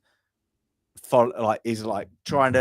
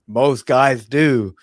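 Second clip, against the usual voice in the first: smug movie line; 7 dB louder, much slower than usual. Retold or imitated. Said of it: "and he goes".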